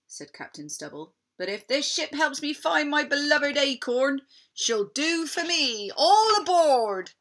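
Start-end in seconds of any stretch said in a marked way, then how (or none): 1.4-7.1 rally the crew aboard; pirate call. Acted. Pirate captain calls crew.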